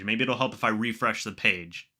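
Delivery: usual speaking voice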